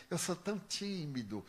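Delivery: soft, bashful mock confession; mock shyness. Artists claiming to be timid, mock shy and soft. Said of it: "os artistas dizem assim".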